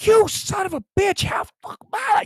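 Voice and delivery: very high squealing mock rage; a hammed-up shrieking tirade; mock phone rant. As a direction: pretend furious caller. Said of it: "call you up and say".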